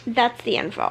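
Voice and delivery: ordinary talk, nothing marked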